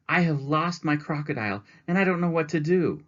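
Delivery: lost and pleading for help; upset. Acted. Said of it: "grew more and more upset".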